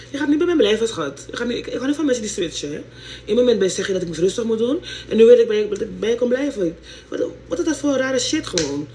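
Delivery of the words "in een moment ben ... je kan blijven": ordinary talk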